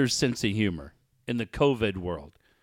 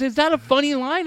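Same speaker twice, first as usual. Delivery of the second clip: higher questioning woman's voice; imitating his friend; 12 semitones higher, 5 dB louder than usual. Host voicing friend asking about her joke, higher voice. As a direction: ask it up high, fishing for approval. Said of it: "She goes".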